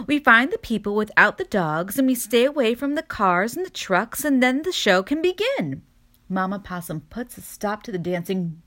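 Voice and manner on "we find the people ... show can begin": excited squeal. A narrator's reading. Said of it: "Augusta squeals".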